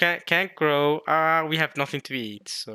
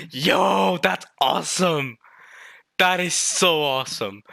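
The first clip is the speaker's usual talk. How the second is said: raised, punchy exclaiming; thrilled; 6 semitones higher, 7 dB louder than usual. Real, not acted. Fan delighted by the reveal, thrilled exclaiming.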